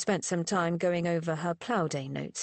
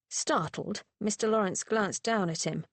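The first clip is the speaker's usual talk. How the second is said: usual speaking voice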